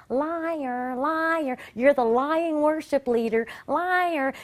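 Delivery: imitating a nagging demon; taunting demon. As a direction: needle them with the accusation. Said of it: "this demon all around my head going".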